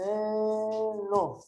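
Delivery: slow, stretched syllables. Shown as a demonstration. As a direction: sound it out as if dictating. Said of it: "separa em sílabas". (sílaba por sílaba, devagar)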